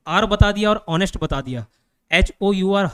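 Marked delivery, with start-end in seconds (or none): none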